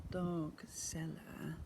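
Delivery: ordinary delivery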